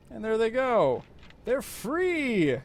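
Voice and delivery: high, loud cheer as they break free; excited cheer. Real, not acted. Cheering his escaping crew, excited and loud.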